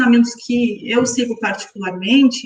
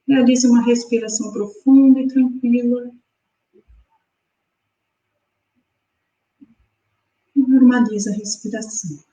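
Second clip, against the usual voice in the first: guided meditation. Acted. Guides breathing exercise.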